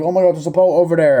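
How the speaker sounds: high, loud, quick mock excitement; excited sighting. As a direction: imitate castaways spotting rescue. Characters spotting a rescue boat.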